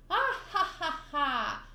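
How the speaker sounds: high first burst, falling away in spelled-out ha-has; hammed-up mocking laughter; acted hearty laugh. Luffy laughs at Gaimon's empty chests, acted laugh.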